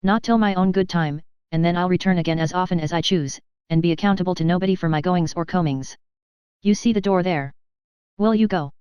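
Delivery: plain reading voice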